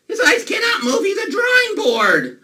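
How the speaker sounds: high, loud mock-outraged insistence; exasperated shout. Exasperated outburst at disbelieving kids.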